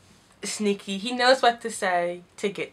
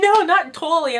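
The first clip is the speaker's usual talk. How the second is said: mock Evangeline; 7 semitones higher, 6 dB louder than usual. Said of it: "And Evangeline like".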